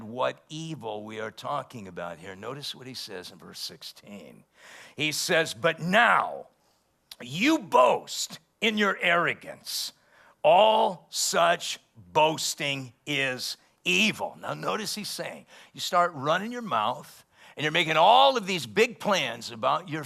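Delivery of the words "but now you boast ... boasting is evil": slow, loud, higher preaching voice; scripture reading. Acted. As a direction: proclaim each word slowly from the pulpit. Preacher reads scripture aloud.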